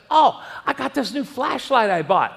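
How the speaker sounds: proud of a new gadget; eager. Retold or imitated. Telling his wife about his new flashlight, eager.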